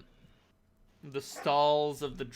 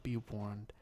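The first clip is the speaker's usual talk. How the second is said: hushed warning; 6 semitones lower, 4 dB quieter than usual. Acted. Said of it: "takes you aside".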